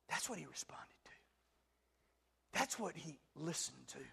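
slow, whispered emphasis; whispered preacher's point; hushed whisper. Acted. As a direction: drop to a whisper to make it sink in. Hushed emphasis after loud preaching.

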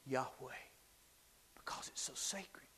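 reverent whisper; whispered "Yahweh" in awe. Shown as a demonstration. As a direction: hush it as something sacred. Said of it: "you can't say it without whispering".

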